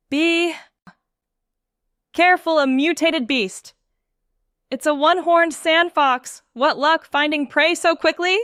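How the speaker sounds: loud, high alarmed call; yelling warning. Acted. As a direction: shout a warning about the beast.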